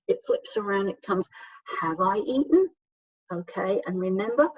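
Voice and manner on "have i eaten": "Have I eaten?" lifting at the end; rising question intonation. Shown as a demonstration. Teacher modelling a yes-no question, rising inflection on "eaten". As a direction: let the pitch climb on "eaten". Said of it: "that rising inflection of your voice".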